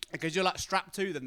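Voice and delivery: usual speaking voice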